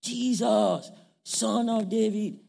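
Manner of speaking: a desperate cry, pitched high and loud; shouting. Acted. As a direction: cry out for help over the crowd. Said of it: "And he started shouting".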